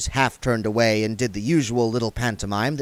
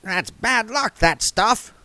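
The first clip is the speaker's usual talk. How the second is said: dwarf voice; 12 semitones higher, slower than usual. A narrator's reading.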